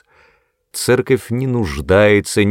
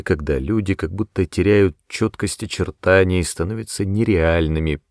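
plain reading voice

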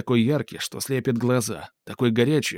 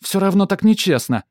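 high, loud stubborn whine; cartoon princess; 8 semitones higher, 6 dB louder than usual. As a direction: refuse to back down, whining. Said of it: "не сдаётся принцесса". (упрямая капризная принцесса)

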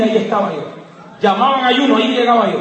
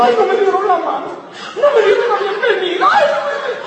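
high, whiny mock lament getting ever higher; mock whining; 10 semitones higher, faster than usual. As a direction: parody a sulking believer, half laughing. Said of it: "llevaban llorando".

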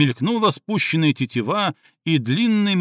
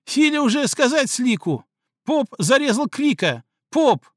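high, cracking wail of fear and pain; terrified dog-man wailing at Слик through tears; voice breaking with fear; 11 semitones higher than usual. A narrator's reading. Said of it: "срывающимся от страха и боли голосом завопил".

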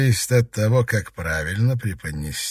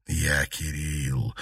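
low, slow, soft reply; hushed, measured statement; quiet; 6 semitones lower, 6 dB quieter, much slower than usual. A narrator's reading. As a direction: say it softly and deliberately. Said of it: "тихо произнёс юноша".

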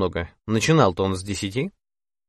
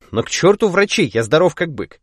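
defiant bravado; 6 semitones higher, 3 dB louder than usual. Acted. Boasting he's fit despite warning.

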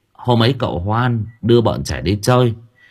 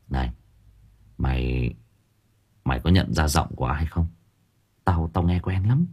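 hushed and quiet; 4 semitones lower, 6 dB quieter than usual. Acted. Hushed question to friend.

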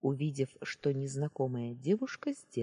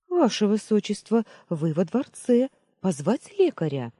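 anxious maid; 6 semitones higher, 8 dB louder than usual. Acted. Maid answers frightened princess.

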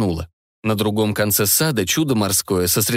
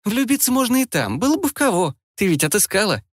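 put-on teasing girl voice; 10 semitones higher than usual. Lilka teases back.

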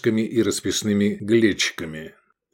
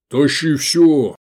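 slow, loud, higher command; loud order; 6 semitones higher, 8 dB louder, much slower than usual. A narrator's reading. Hungry customer to innkeeper, commanding order. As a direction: order it with full appetite. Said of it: "велел Гефестай".

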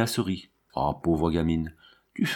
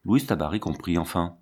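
plain reading voice

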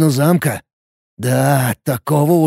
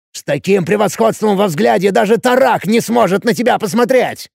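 high, heated insistence; горячий спор; 7 semitones higher than usual. A narrator's reading. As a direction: argue urgently with raised voice. Спор на крике продолжается. (горячо, на повышенных тонах)

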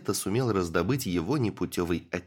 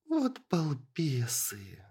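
slow, exasperated scolding; reproachful; 7 semitones higher, much slower than usual. A narrator's reading. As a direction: drag it out, rolling the eyes at them. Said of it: "укорила их Эстель". (укоризненно, медленно)

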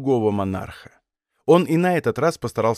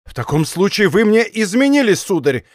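higher, angry accusation in narration; furious exclamation; 5 semitones higher than usual. A narrator's reading. King accuses captain of betrayal, furious. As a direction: accuse him in a burst of rage. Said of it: "воскликнул король в порыве неукротимой ярости".

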